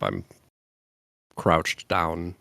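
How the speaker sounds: normal talking voice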